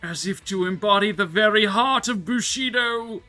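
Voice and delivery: loud, grand ramen critic verdict; rapturous tasting food, savouring each word. Pretend. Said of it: "[name] food critic voice activate".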